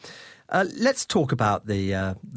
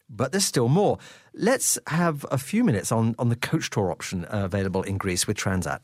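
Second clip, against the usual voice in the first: ordinary talk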